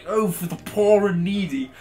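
mock condescension with a small lift in pitch; lightly hammed-up condescending quote; comically condescending. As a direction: imitate a comically condescending remark. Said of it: "was so comically condescending".

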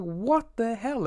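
reacting to the killer in the cabin with slow, high mock disbelief. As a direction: stretch it slowly, high and incredulous. Stalker found sitting in cabin.

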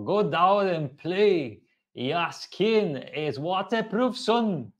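high, slow, loud mock-Scottish voice; hammed-up Scottish accent, pitched well up; Scottish accent attempt. Shown as a demonstration. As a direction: put on a big sing-out Scottish accent, drawn out. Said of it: "you go with the Scottish accent".

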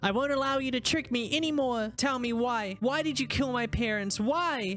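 put-on high, pleading girl voice; voicing young Fran. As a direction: play the frightened little girl.

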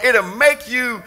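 normal talking voice

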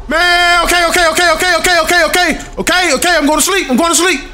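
high, frantic repeated pleading; frightened, words tumbling out high; panicked compliance. Real, not acted. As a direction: give in quickly in a high panicked voice. Panicking, obeying a scare in game.

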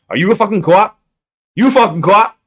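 loud, high mock Boston accent. Mimicking someone from Boston for viewers, loud Boston accent. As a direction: shout it as a hammed-up Bostonian. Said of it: "if I hear someone from Boston".

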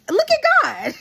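wysoki, radosny okrzyk; joyful exclamation. Real, not acted. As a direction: wykrzycz to z radością, wysoko i głośniej. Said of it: "praising God, exclaimed".

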